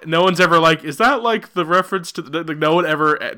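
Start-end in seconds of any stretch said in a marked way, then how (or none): 0.8-2.4 high, hesitant questioning voice; mock character voice. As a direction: ask it like a puzzled onlooker.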